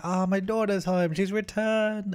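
high, slow, gushing proud dad; a joyful father welcoming his girl home; delighted father. Acted. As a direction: coo over the returned daughter. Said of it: "He's like".

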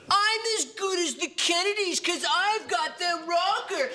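high, laughing brag; gloating buyer. Acted. Auctioneer mocks the buyer in a high gloating voice. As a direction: crow like a snob.

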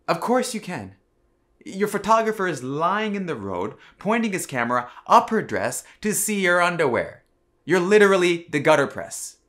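loud, high-pitched outrage; angry rant. Acted. Berating the gutter press over its photographer, loud angry rant. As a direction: rail at them, high and loud, building to the end.

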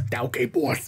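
put-on old-time gangster. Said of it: "the little Ratling sounds like".

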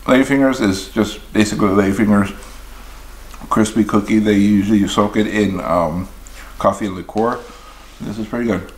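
chewing while talking; speaking between bites; mouth full. Real, not acted. Seen 0: eats donut and cookie throughout, mouth working between bites.

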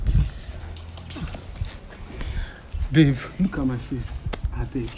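hushed, low begging; tearful pleading. Acted. Crying boyfriend begging girlfriend to look at him, hushed tearful plea. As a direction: plead softly, voice dropping away almost to breath. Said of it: "Babe, stop crying now".

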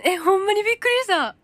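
loud startled exclamation; real surprise. Real, not acted. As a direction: blurt out genuine shock. Reacting to a surprising comment.

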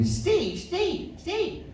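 high, soft calling of a name; an old English fan hailing him; calling out Steve. Retold or imitated. Fan greeting him after a gig, high soft call.

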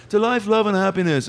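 high-pitched, lilting star impression; high mimicked celebrity voice. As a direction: greet in a high put-on voice. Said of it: "Paul McCartney's here".

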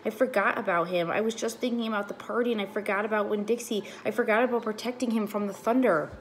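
distressed. A narrator's reading. Girl panicking over lost dog.

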